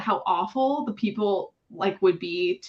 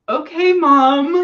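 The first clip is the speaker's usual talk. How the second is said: sarcastic okay; 7 semitones higher, 10 dB louder, much slower than usual. Retold or imitated. Retold reply to mom.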